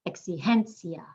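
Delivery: "Exigencia" stressed ek-si-HEN-sia; second-to-last stress. Shown as a demonstration. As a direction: lean on HEN in "Exigencia", "ia" as one sound. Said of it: "We're emphasizing the second to last".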